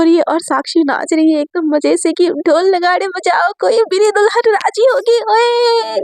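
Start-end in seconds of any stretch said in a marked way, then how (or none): 2.3-5.9 merry celebration. A narrator's reading. Sakshi dancing merrily.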